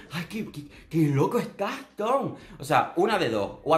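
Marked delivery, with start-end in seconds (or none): 0.1-2.4 high, gushing imitation; mock fawning flatterer. Contestant flattering Tom, high and hammed-up fawning. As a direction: swoon over him in a mocking way. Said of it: "y tú".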